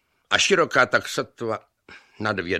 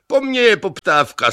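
high, loud self-promotion; shouting boast; 13 semitones higher, 9 dB louder than usual. A narrator's reading. Rival on the footbridge claims he is in demand, shouting. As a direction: brag at full voice. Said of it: "křičeli na sebe a nerozuměli si".

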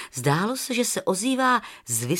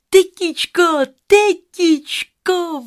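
calling high and loud, second drawn out; 10 semitones higher, 9 dB louder, slower than usual. Acted. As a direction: call to the aunt from afar. (volání na tetu z dálky)

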